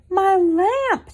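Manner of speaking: high, loud cry of dismay; Mama gasps at her broken lamp; mother bear's high, loud wail over her lamp. Acted.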